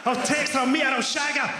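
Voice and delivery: high, excited inner monologue; acted lustful thinking. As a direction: get carried away by a bad idea, pitched right up. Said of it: "you go".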